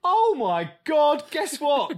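high, loud, drawn-out revelation; imitating someone bursting with news; mock excited discovery. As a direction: announce a huge discovery, hammed up. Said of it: "man to discover that was like".

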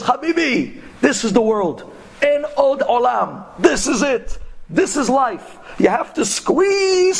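high, insistent tirade with drawn-out key words; heated ranting. Retold or imitated. Taxi driver lectures the scholar on living now, heated. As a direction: cabbie worked up, pitch kept high. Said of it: "He starts screaming".

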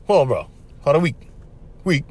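low, unbothered monotone; spaced-out, low-key delivery; dial-tone local brother. Acted. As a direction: mimic a laid-back brother barely bothering with words. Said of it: "They're like on dial tone".

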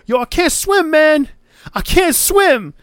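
high panicked mock shout; panicked Ortiz. Ortiz drowning in the pool, panicking: high mock shout. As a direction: yell it like you're going under. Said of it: "Ortiz is like".

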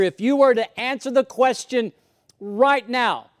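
loud, high preacher's call; fervent sermon delivery; impassioned preaching. Acted. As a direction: preach it out to the room. Preaching at full force.

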